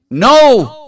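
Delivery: loud, high-pitched exclamation; emphatic denial. Acted. The Imam rejects Ibn Mahziyar's excuse, exclaiming loudly. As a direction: burst out with a forceful refusal.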